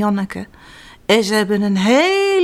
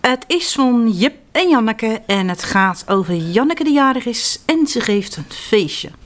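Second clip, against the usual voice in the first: usual speaking voice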